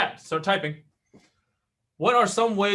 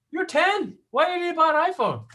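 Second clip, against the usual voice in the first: high, mock-incredulous father; incredulous dad; 13 semitones higher, 3 dB louder than usual. Dad answering his ten-year-old, high and incredulous. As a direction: play the dad who can't believe it. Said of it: "what did my daughter do".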